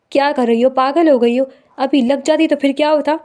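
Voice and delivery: loud, furious yelling at her; angry shout. Acted. Husband shouting angrily at wife cutting her own neck. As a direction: shout in alarmed anger. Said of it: "غصے سے چلایا".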